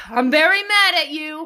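high, loud mock-tearful child; imitated mad toddler. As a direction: whine it like an upset little boy. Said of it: "he just goes".

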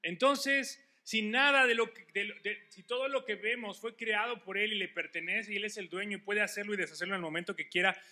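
high, loud preaching at full force; fiery preaching. Acted. Pastor preaching hard to the beloved brothers, loud and high. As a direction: preach it out over the congregation.

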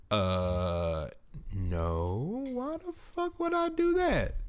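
mock refusal. Retold refusal.

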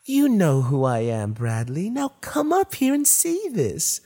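high, eerie coaxing voice; strange voice. Acted. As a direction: lure him up the stairs. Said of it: "The voice from the dark staircase".

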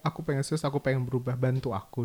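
soft, subdued imitation of a plea; retold quiet plea. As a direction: quote the partner's plea under your breath. Retold partner's plea to change. (menirukan permohonan dengan suara pelan)

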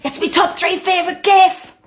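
loud, high excited fan voice; excited squeal. Retold or imitated. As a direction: shout it like a thrilled fan. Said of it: "It's like".

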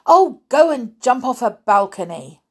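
loud, high fed-up cry; exasperated outburst. Acted. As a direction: throw it at him in exasperation. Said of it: "in exasperation, Jasmine cried".

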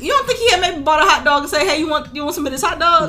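fast, high, giggling; laughing tease. Real, not acted. Host teasing her co-host, laughing, high and fast.

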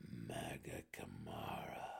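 slow, low whisper; breathed. A narrator's reading. Warden recognizing the drow's eyes, breathed whisper. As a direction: let it out on a stunned breath. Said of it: "Belwer breathed".

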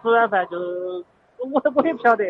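ordinary talk

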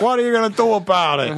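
high, cocky taunt; defiant grandpa. Retold or imitated. Grandfather lighting a cigarette with chemical hands, cocky and defiant. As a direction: dare them to stop him. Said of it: "it was like".

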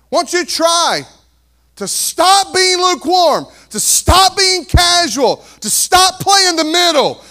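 full-force altar call shout; shouting sermon challenge. Acted. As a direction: push each "stop" harder.

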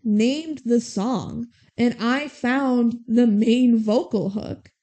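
slow, emphatic retold protest; indignant retelling. Rhea claims her part in the song, retold with slow indignation. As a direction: drag out each claim to credit.

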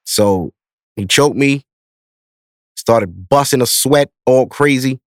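ordinary talk, nothing marked

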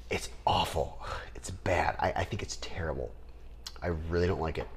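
a hushed confidential verdict; whispered aside. Real, not acted. Sharing his verdict on the writing, whispered.

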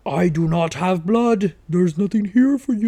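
drawn-out put-on mosquito speak; mosquito language. Tricking mosquitoes away from you, mock mosquito language. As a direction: slowly chant it as a mock mosquito translation. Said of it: "if you could learn mosquito language".

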